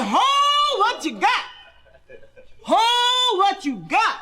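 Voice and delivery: stomp and holler in disbelief; husband shocked at the owl; amazed shout. Retold or imitated.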